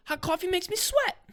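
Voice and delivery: high whiny voice; high put-on whine. Real, not acted. Complaining about hot coffee in a high whiny voice. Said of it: "repeated line".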